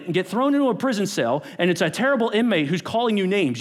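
normal talking voice

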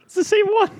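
squeaky laugh through the nose; laughing, air whistling through the nose. Real, not acted. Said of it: "he's, like, whistling through his nose".

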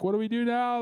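high, loud panicked yell; retold scream. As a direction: scream the question out over the surf. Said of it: "I just screamed at".

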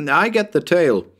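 put-on high-pitched child claiming a prize; eager little boy. Tommy claims the rabbit's tail, high eager boy voice. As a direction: pipe up high like a keen small boy. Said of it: "Tommy put in his claim".

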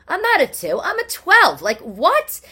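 high, loud disbelief; incredulous outburst. Acted. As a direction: burst out loudly, voice leaping up. Reacting to flattering size label.

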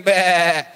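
short mock bleat; imitated sheep bleat.

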